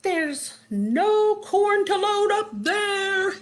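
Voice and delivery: high, drawn-out holler; singsong, stretched boss's shout; field boss calling. A narrator's reading. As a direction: call it out long and high, up to someone above. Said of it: "his field".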